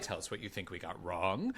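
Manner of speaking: creepy deep voice. Pretend. Deep creepy voice, Beetlejuice callback.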